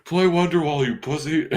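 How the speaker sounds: high-pitched pretend heckler call; mock heckling. Co-host joining in as a concert heckler, high mock jeer. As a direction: jeer a joke request at the band.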